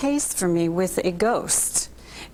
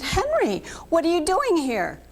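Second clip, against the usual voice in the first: astonished question; 10 semitones higher than usual. Retold or imitated. Astonished at seeing Henry.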